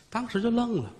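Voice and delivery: plain reading voice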